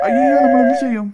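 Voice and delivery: helpless panic; panicked. Acted. Husband panics before the ghost, loud frightened cry.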